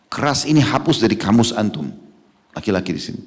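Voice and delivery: ordinary talk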